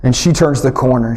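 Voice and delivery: ordinary talk, nothing marked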